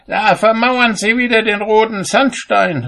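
high-pitched indignant carping; calling out. A narrator's reading. Cobbler finds fault with builders from his window, high indignant call. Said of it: "rief er".

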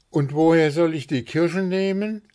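loud, high, helpless question; dejected question. A narrator's reading. Puh with drooping shoulders lacking cherries, dejected. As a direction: ask it as a put-upon complaint. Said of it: "fragte Puh und ließ die Schultern hängen".

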